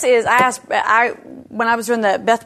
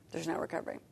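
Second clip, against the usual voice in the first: much softer, nearly gone; fading despair; 5 semitones lower, 18 dB quieter, much faster than usual. Retold or imitated. Echoing the reader's despair, a much softer fading repeat. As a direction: let it die away to almost nothing.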